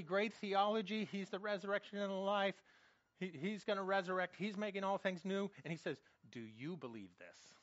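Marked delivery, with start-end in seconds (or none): 6.3-7.5 soft quoted question. Retold or imitated. Quoting Jesus to Martha.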